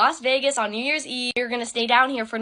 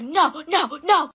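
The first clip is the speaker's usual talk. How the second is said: higher mock yell of the lady; retold scream; 5 semitones higher, much slower than usual. Lady refusing to hand back the suitcase, mock scream. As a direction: imitate her shouting no, no, no. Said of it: "screaming at this point".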